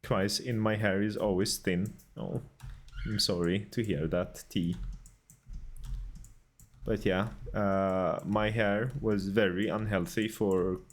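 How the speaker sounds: hammed-up mock Swedish accent in casual chat; put-on Swedish lilt, badly faked; terrible Swedish accent. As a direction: chat away in a deliberately bad Swedish accent. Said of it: "Thank you for redeeming terrible Swedish accent".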